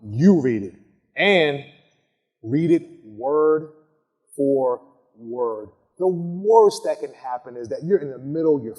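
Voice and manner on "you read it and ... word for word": slow, spaced word for word; slow emphatic word for word; deliberate stress. Shown as a demonstration. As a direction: land each word on its own. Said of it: "do not give up that power".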